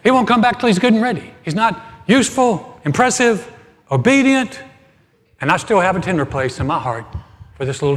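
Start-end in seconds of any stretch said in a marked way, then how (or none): 0.0-4.6 higher and louder with each fault; rising exasperation. Acted. Pastor listing his dog's flaws, building to loud and high.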